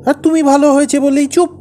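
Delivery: high-pitched, heated reproach; angry accusation. Acted. Husband accuses wife of secretly rejecting his story, angry. Husband quarrels over wife's silence.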